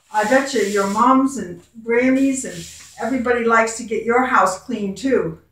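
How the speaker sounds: usual speaking voice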